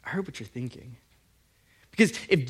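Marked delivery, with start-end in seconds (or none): 0.0-1.0 hushed, breathy aside; knowing whisper. Retold or imitated. Jesus answering the scribes' thoughts, soft whisper. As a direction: whisper it like a secret you caught. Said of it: "And Jesus is like".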